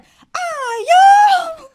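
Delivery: high, loud cry of "Ayu!"; Guyanese shout "Ayu". Retold or imitated. TikTok influencer yelling in a fight, loud high "Ayu!". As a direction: shriek it like a fight call. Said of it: "she was like".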